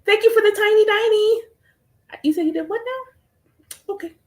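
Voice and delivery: high, mock-polite disbelief; sassy disbelief. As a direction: feign calm disbelief. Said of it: "I'm like".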